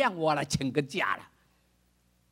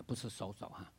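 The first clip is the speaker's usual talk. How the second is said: ordinary delivery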